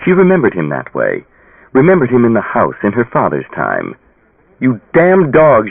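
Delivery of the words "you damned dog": slow, high, loud curse; furious cry. A narrator's reading. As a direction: spit it out at him in rage. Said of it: "she cried".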